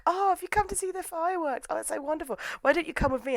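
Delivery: put-on very high, soft girly voice; gushing girl. Sakagawa inviting Shizuka along, mock-sweet high voice. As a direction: coo it sweetly in a mock-girlish voice.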